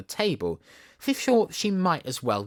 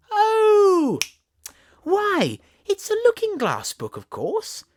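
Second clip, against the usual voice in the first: much higher, loud delighted gasp; sudden discovery; 7 dB louder, slower than usual. Acted. Alice realising the book is mirrored, delighted high exclamation. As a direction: squeal it as the idea hits.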